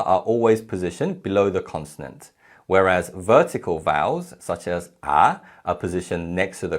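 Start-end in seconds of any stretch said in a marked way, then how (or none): none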